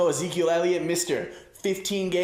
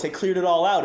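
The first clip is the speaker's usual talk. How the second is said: ordinary talk, nothing marked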